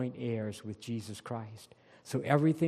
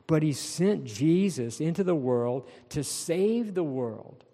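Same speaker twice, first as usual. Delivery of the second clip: higher and louder, driving each phrase home; full-force preaching; 4 semitones higher, 3 dB louder than usual. Acted. Pastor pressing the congregation, fervent preaching. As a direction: preach it out, building fervour.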